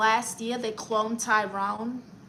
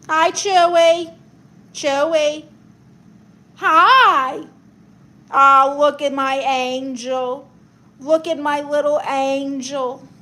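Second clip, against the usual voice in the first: pet talk; 9 dB louder than usual. Real, not acted. Cooing at her sleeping dog.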